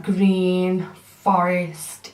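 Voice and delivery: ordinary talk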